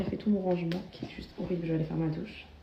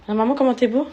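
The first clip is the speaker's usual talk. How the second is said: put-on high, louder baby-talk voice for her son; voicing the baby; 4 semitones higher, 7 dB louder than usual. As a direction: answer for the baby, sing-song and bright. (petite voix de bébé aiguë et plus forte)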